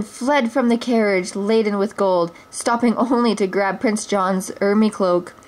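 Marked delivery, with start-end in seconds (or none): none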